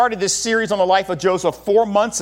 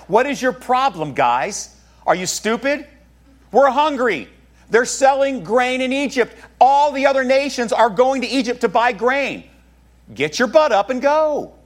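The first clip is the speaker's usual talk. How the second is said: higher, exasperated father berating; 7 semitones higher than usual. Retold or imitated. Jacob yelling at his sons to go, exasperated and raised. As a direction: push it up like a fed-up dad yelling at idle sons. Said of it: "paraphrased father berating sons".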